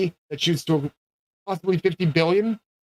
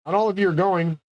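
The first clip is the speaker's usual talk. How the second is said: normal talking voice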